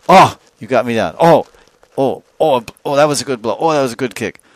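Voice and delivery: hammed-up wrestler taking hits; hit in combat. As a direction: react as if knocked down, relishing each blow. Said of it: "hit hard in the chest, thrown on the ground".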